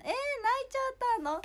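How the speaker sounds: high, loud surprised cry; startled gasp at a crying fan. Real, not acted. (泣いてる相手に驚いて高い声で)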